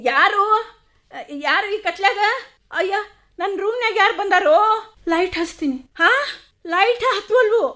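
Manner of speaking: high, scared calls into the dark; terrified, asking who is there; frightened cries. Acted. Raji alone in a dark room, frightened high cries.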